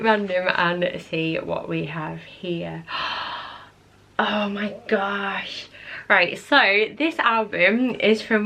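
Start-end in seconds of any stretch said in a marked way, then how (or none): 2.9-3.6 gasp. Real, not acted. Looking through old photo albums. 4.2-5.7 slow, breathy whispered oh my gosh; awed whisper. Real, not acted. Vlogger opening an old album, in a slow awed whisper. First sight of old photos.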